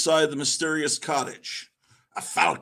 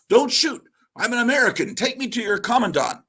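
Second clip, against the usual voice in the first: raised, higher shout of surrender; urgent call; 7 semitones higher, 4 dB louder, faster than usual. Acted. Daredevil shouting to the charging soldier, urgent. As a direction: call out so he won't shoot.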